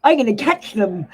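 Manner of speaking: high, loud burst of resolve; mock battle cry. Tarot reader retelling her vow to catch spirits, loud mock cry. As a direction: shout it like charging into a fight. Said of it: "I thought".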